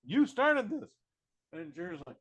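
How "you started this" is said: much higher, louder squeaky mock tough guy; France squeakily threatening the Germans during talks; mock French threat. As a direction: shriek the threats like a cartoon bully. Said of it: "And France is like".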